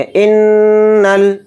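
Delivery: इन्नल with a long nasal hum on the nn; इन्नल chanted slowly with a nasal hold; nasal ghunna on the doubled n. Shown as a demonstration. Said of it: "नून को लाम में मिलाकर पढ़ेंगे".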